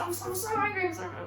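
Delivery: high, small cartoonish voice; exaggerated angry customer. Customer complaining to theatre worker, hammed-up anger. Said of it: "she didn't go like that".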